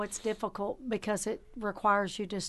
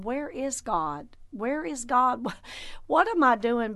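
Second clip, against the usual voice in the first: bewildered questioning, building louder; acted bewilderment; 4 semitones higher, 5 dB louder than usual. Believer who went God's way and landed nowhere, bewildered questioning. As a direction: voice someone lost and asking, rising in pitch and volume. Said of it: "you find yourself in places you're going".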